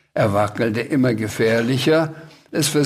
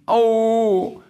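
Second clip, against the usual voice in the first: cried-out ouch; 9 semitones higher than usual. A narrator's reading. Sams lands on something prickly, crying out. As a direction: yelp a long high ow. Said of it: "schrie der Sams".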